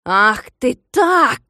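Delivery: loud, slow enraged outburst; choking with rage. A narrator's reading. Klops as the boy escapes, breathless rage. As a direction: gasp it out in fury. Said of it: "задыхаясь от гнева".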